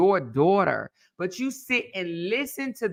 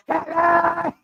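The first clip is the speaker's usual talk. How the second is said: mock phone shout, much higher; imitating Miss Linda's hello; 11 semitones higher than usual. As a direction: bark the hello into the phone like a brash caller. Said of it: "how she answered the phone".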